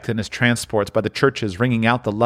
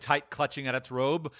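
plain reading voice

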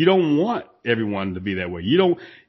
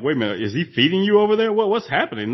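higher, mock-shocked questions; mock incredulous; 7 semitones higher than usual. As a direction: gym owner mimicking the talk about a member, mock-surprised. Mocking gossip about the gym.

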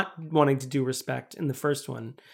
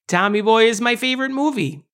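hammed-up Chicago accent, pitched higher; 4 semitones higher than usual. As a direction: play the Chicagoan, climbing in pitch. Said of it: "we haven't done a single Chicago accent".

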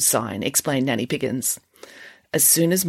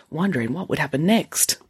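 plain reading voice

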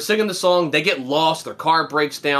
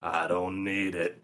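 slow, low, much softer strain; parched cartoon character; 8 semitones lower, 10 dB quieter, slower than usual. Friend imitating dried-out SpongeBob refusing water, slow and low. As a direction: drag it out quietly, drained dry. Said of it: "completely drained and dry, and he's like".

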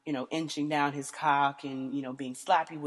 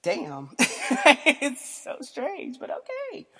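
laughing, high and drawn out, on "strange"; bemused laugh; 8 semitones higher, 4 dB louder, much slower than usual. Retold or imitated. Retelling her bemused reaction to her partner, laughing. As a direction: laugh in disbelief at his kink. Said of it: "I'm like".

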